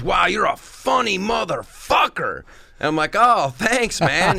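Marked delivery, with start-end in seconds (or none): 0.0-2.4 higher, punchy amazed praise; emphatic admiration. Retold or imitated. Stranger backstage praising a comic, emphatically impressed. Man's praise in full, with cuss. 3.2-4.4 high, louder thank-you; gushing thanks. Retold or imitated. Comic thanking an admirer backstage, high and gushing. Answering a stranger's praise.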